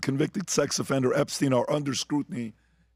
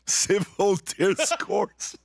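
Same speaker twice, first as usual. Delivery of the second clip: laughing through the words; much slower than usual. Real, not acted. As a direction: break into laughter mid-phrase. Laughs reading the story.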